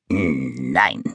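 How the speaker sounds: tiefer, knurriger Ton, tiefer gelegt; knurrend. Acted. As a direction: ein mürrisches „Nein“ knurren. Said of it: "knurrt das Monster". („Nein“ tief und knurrig, wie ein grollendes Monster)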